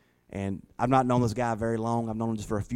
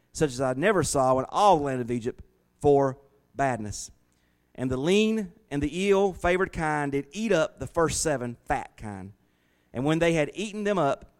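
raised, measured Bible reading voice; scripture reading; 3 semitones higher than usual. Acted. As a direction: read the verse out like a preacher. Reads Genesis aloud.

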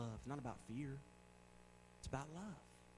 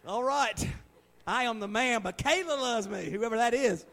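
loud, high, hammed-up swagger; mock boast; 6 semitones higher, 8 dB louder than usual. Preacher jokes about being loved by a stranger, mock boastful. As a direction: crow it proudly as a joke. Joking boast over the message.

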